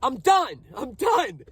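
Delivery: strained, high retelling of a furious outburst; retold angry scream. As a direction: imitate screaming in anger without full volume. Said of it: "I just screamed".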